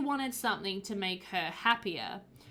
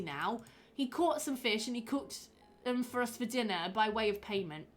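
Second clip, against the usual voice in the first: put-on Cockney accent; 3 semitones higher than usual. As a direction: give the suspect a broad Cockney voice. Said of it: "The accent makes it come alive".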